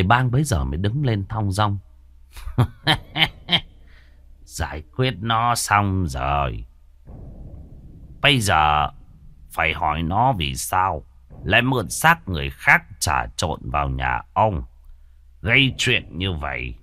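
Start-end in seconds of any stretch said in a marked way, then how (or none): 2.4-3.6 smug laugh. Acted. Sorcerer triumphant after capture. 4.4-16.7 calm sorcerer. Acted. Sorcerer speaks after subduing demon.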